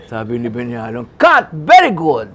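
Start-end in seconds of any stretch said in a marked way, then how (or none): 1.2-2.3 director yelling cut. Retold or imitated. Director shouting cut to the crew, loud and pleased. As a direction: call cut across the set.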